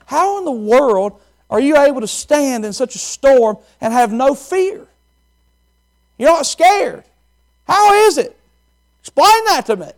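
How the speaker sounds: high, loud, rattled wife's voice; scared wife. Acted. Scared wife begging her calm husband, high and loud. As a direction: voice the frightened wife pleading. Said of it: "She was nervous".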